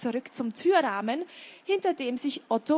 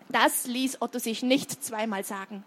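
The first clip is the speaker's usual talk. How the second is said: ordinary delivery, nothing marked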